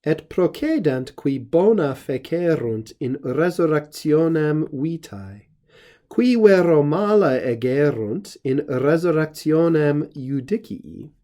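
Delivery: measured, solemn reading of a Latin verse; formal Latin reading. Shown as a demonstration. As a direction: read the Latin like scripture, unhurried. Reads the Latin verse aloud.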